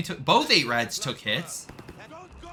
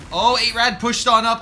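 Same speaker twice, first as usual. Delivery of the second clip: excited; 8 semitones higher, 10 dB louder than usual. Real, not acted. Live call of a sudden push.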